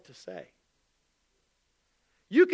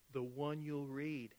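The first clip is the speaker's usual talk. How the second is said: quiet punchline; 7 semitones lower, 14 dB quieter, slower than usual. Real, not acted. Pastor's punchline answer.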